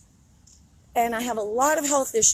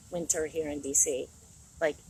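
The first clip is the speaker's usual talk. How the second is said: normal talking voice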